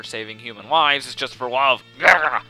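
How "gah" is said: loud, short "gah" of frustration; loud frustrated groan; exasperated yell. Pretend. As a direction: burst out in mock exasperation. Exasperated outburst at the game.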